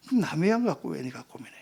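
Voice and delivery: low, unvoiced whisper of a gentle rebuke; hushed divine rebuke; whispered voice of God. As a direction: whisper the question tenderly. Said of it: "주님께서".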